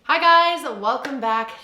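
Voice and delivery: bright loud high hello; big upbeat vlog hello; excited greeting. Real, not acted.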